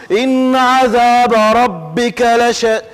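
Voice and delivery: slow chant with drawn-out vowels; flowing Quranic recitation. Shown as a demonstration. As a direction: let the recitation flow on, notes held long. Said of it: "e vai indo".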